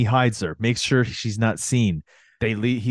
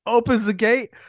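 normal talking voice

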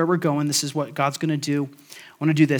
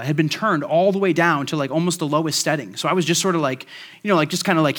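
ordinary delivery

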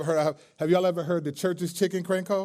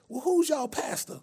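put-on old church lady voice; 12 semitones higher than usual. Prank caller asking restaurant about church, old lady voice. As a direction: ask sweetly like an elderly churchgoer.

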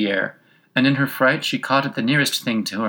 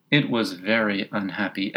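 plain reading voice

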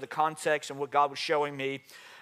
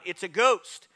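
cried out in fear; 5 semitones higher, 6 dB louder, slower than usual. A narrator's reading. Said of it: "They cried out".

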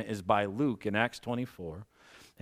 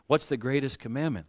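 ordinary delivery

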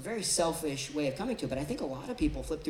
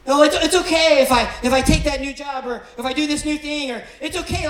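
much louder, higher, hammed-up relief; mock eager relief; 8 semitones higher, 13 dB louder than usual. Imitating a Bible-flipper to the congregation, loud mock relief. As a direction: shout the lucky verse like a prize found. Imitating people seeking lucky verse.

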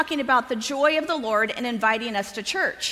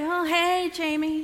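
reluctant greeting; 5 semitones higher, slower than usual. Retold or imitated. Reluctant reply to neighbor's greeting.

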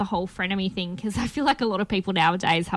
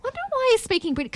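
high-pitched, quick wondering aside; retold puzzled thought; 14 semitones higher, much faster than usual. Viewer wondering about the actor's accent, retold puzzlement. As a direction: voice a baffled thought, pitched right up. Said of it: "I was like".

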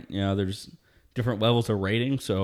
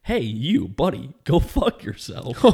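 laughing insult; 3 semitones higher, 3 dB louder, slower than usual. Retold or imitated. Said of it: "I'm like".

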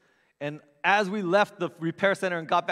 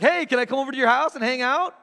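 mock eager ask; 9 semitones higher, 8 dB louder than usual. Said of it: "would you be like".

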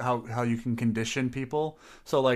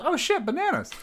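much higher excited monkey voice. Retold or imitated. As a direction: squeal with delight at the bananas. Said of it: "A new monkey comes in and goes".

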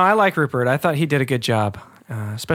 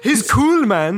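bad South African accent; 6 semitones higher, much slower than usual. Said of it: "Oh, I suck at that".

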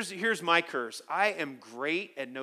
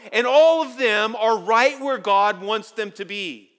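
loud, high, driving sermon voice; fiery preaching; 7 semitones higher, 9 dB louder than usual. Acted. Pastor exalting God's power to the congregation, full-force preaching. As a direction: preach it to the back row.

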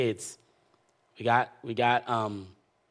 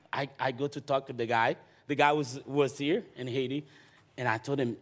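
usual speaking voice

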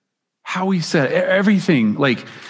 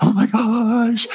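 giddy self-quote; 8 semitones higher than usual. Retold or imitated. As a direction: squeal it like a smitten teen who can't believe it. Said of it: "I was sort of like".

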